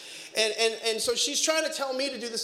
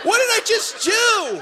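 higher, louder, slow horrified shout; panicked shout; 12 semitones higher, 8 dB louder than usual. Retold or imitated. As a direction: yell in comic regret. Dad regrets question.

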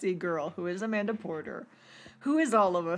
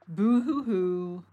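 mock crying; slower than usual. Mocking a character's self-pity.

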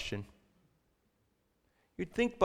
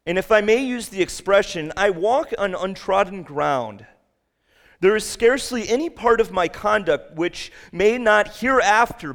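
soaring sermon delivery; preaching at full force; 9 semitones higher, 8 dB louder than usual. Acted. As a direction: boom it out from the pulpit. Quoting Washington at full force.

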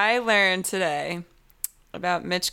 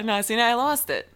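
ordinary talk, nothing marked

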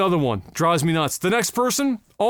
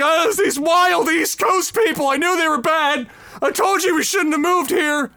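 mocking the Ontario couple; 8 semitones higher than usual. Mocking the Ontario couple complaining back home, high and whiny. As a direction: whine like a snooty newcomer scared off. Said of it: "they're gonna go home and be like".

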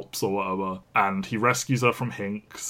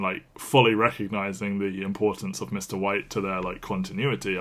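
ordinary talk, nothing marked

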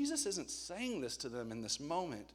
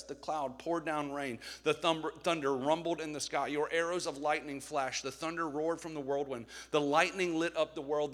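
louder, declaiming a psalm to the room; scripture reading; 5 dB louder than usual. Acted. Preacher reading a psalm aloud to his church, declaiming. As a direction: proclaim the psalm with weight.

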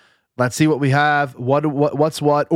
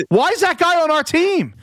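exasperated outburst; 11 semitones higher than usual. Real, not acted. Fan furious about his team's receiver.

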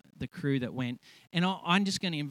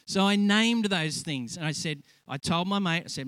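ordinary talk, nothing marked

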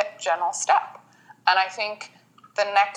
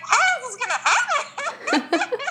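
panicked laugh. Retold or imitated. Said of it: "'cause I'm like".

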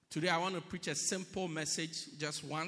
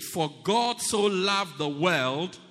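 mean it as you say it; 7 dB louder than usual. Shown as a demonstration. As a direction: proclaim each phrase with weight. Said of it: "And mean it as you say it".

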